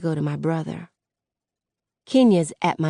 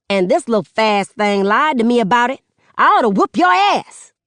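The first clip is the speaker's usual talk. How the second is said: much higher, loud outraged mother's voice; furious mom, voice pitched up and loud; angry mother; 6 semitones higher, 5 dB louder than usual. Acted. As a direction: rant at him like the mother who raised him. Mother threatening her daughter.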